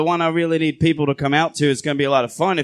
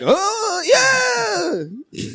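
squeaky mock cry. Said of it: "And you're like".

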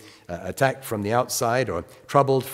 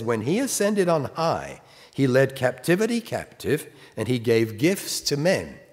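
raised, measured Bible reading; scripture reading; 4 semitones higher than usual. Acted. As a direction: read the verse out with weight. Reads scripture aloud.

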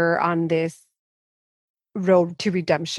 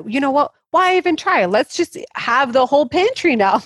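higher and louder, a throw-it-all-away giving up; mock reckless binge voice; 8 semitones higher, 5 dB louder than usual. Quoting the spiral voice to the host, higher and louder in mock defeat. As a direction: ham up the why-bother urge to raid the pantry.

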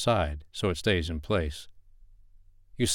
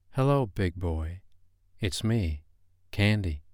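femme fatale; slower than usual. Acted. Texting husband as stripper Candy.

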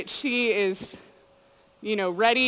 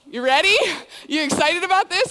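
higher and louder, sarcastically upbeat; mock excited; 7 semitones higher, 6 dB louder than usual. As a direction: pump her up with fake cheer. Mock-cheering friend at airport.

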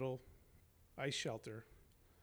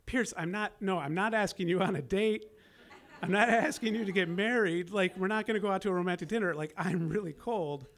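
loud, laughing, half-exasperated protest; exasperated pleading; 6 semitones higher, 9 dB louder than usual. Retold or imitated. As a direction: blurt it out, amused and desperate. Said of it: "I was like".

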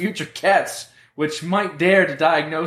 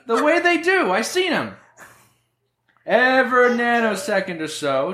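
loud; 7 semitones higher than usual. Acted. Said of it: "why are you so loud".

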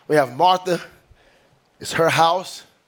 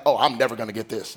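quick, low, resigned mutter; defeated sigh; 7 semitones lower, faster than usual. Retold or imitated. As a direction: give up before you start, rushing it. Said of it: "was like".

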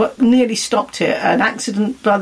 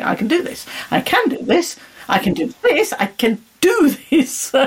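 self-pep mantra; 6 semitones higher than usual. Retold or imitated. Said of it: "I spent a lot of my life going".

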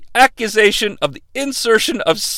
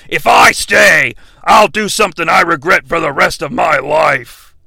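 hammed-up brooding outburst; Kane; 4 semitones lower than usual. Voices Kane answering Hurricane.

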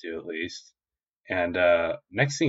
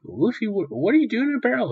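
high, rushed mock-flustered question; bewildered Kobe; 14 semitones higher than usual. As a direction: sputter it fast and very high.

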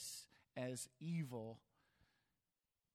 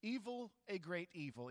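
"Evil" jumps up high, then "a great evil" falls away; stress on evil; 4 semitones higher than usual. Shown as a demonstration. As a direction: hit "Evil" hard and high, let the rest drop. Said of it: "Evil two times to stress the emphasis".

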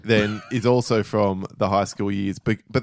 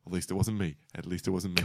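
soft, low inner voice; private relieved aside; 3 semitones lower, 10 dB quieter than usual. Retold or imitated. Onlooker thankful classmate got caught, quiet relieved aside. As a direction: say it quietly to yourself. Own relieved thought, retold.

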